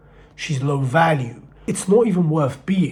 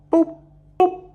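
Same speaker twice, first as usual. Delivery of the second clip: high, cartoonish boop boop; boop sound effect; 5 dB louder than usual. Fish let off the hook, a high cartoon boop. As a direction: squeak it like a line snapping loose.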